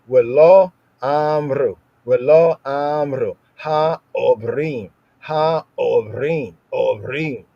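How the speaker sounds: slow, careful Hebrew reading; Hebrew recitation. Shown as a demonstration. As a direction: recite the Hebrew slowly, syllable by syllable. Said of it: "It's a hard A".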